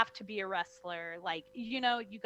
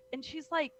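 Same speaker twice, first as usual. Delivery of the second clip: ordinary talk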